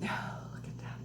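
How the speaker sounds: hushed, voiceless wonder; awed whisper. Real, not acted. Vlogger showing handmade gift to viewers, awed whisper.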